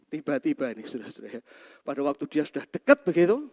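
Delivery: normal talking voice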